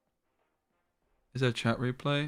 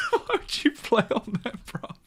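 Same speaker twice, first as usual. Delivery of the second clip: laughing; 8 semitones higher than usual. Retold or imitated. Streamer cracking up while reading a friend's chat message, laughing. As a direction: read through laughter, pitched up. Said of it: "Woody got me".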